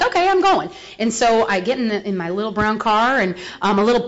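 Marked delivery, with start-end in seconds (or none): 0.0-0.7 higher, bright eager agreement; eager okay. Retold or imitated. Telling her sponsor she will go, eager and pitched up. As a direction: jump at it, pitched up. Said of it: "And I'm like".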